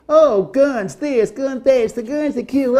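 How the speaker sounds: high, slow mocking quote; sneering impression of critics. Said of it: "a lot of people say".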